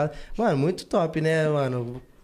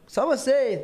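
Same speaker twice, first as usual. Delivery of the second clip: higher-pitched stage call with a laugh; calling to the audience; 7 semitones higher, much slower than usual. Retold or imitated. Performer reenacting a show played to camera, playful crowd call. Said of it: "eu falava pra câmera".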